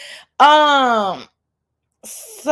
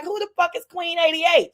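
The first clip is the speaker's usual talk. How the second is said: hammed-up raised-pitch shout; mock shout; 6 semitones higher than usual. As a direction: yell it like a boss picking off rivals one by one. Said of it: "I'm like".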